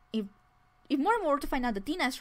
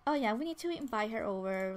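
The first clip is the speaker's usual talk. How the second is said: normal talking voice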